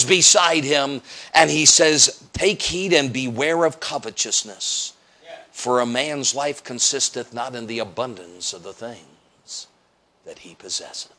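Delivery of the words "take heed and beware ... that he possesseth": hushed solemn scripture. Retold or imitated.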